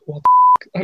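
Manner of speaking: very high, loud squeal of what the f-; a hammed-up squealed what the f-; shocked outburst. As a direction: squeak it out in mock horror. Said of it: "And then he was like".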